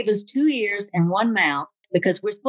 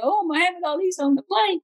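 excited fans; 9 semitones higher than usual. Retold or imitated. Passengers spotting a celebrity.

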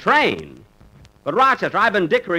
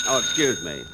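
normal talking voice

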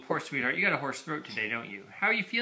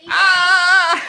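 playful high shriek; mock scream; 12 dB louder than usual. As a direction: shriek as if the dino got you. Mock fright from the dinosaur.

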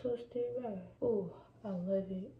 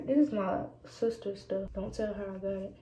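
normal talking voice

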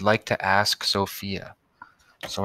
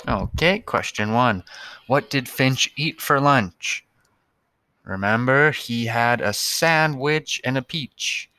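usual speaking voice

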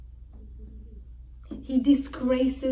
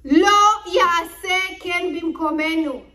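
defiant declaration; 5 semitones higher, 8 dB louder than usual. Retold or imitated. Sons' defiant Hebrew declaration.

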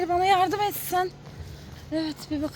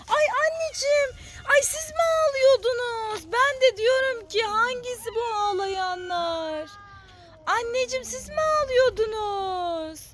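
pitched-up sweet talk to tiny kittens; 4 semitones higher than usual. Real, not acted. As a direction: coo at the crying kittens like babies. Cooing at crying kittens in cages.

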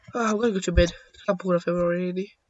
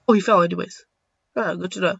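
ordinary talk, nothing marked

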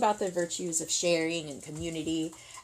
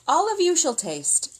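grand announcement; 10 semitones higher, 4 dB louder than usual. Acted. Soldier announces feast.

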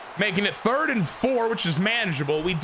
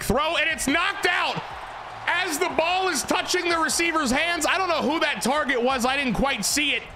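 excited play-by-play; 9 semitones higher than usual. Acted. Calling the knocked-out pass to viewers, excited and high. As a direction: pitch jumps much higher, fast commentator rush.

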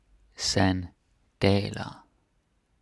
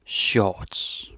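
"Shorts" said with a Danish accent; Danish-accented model pronunciation of Shorts; 3 dB louder than usual. Shown as a demonstration. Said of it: "But with the Danish accent".

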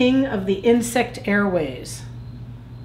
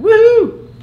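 high, loud whoop; gleeful whoop; 13 semitones higher, 9 dB louder than usual. Acted. Plankton cheers the best friends' fun, high whoop. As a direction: whoop it out far above your usual pitch.

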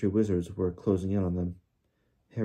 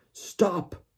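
high, urgent yell; yelled command; 10 semitones higher than usual. A narrator's reading. Father halting an attack on his son, yelling. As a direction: cry out to halt them. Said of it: "yelled the voice he recognized".

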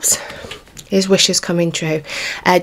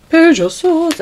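delighted card call; 9 semitones higher, slower than usual. Real, not acted. Repeats the card name.

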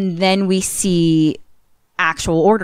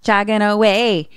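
higher, drawn-out singsong narration; cheery storybook singsong; playful scene-setting; 4 semitones higher, slower than usual. Pretend. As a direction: lilt through it like a silly nature tale.

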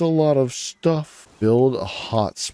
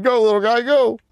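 much higher, excited cheering; squeaky cheer; 13 semitones higher, 4 dB louder than usual. Real, not acted. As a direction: cheer the little guy on. Cheering small pal.

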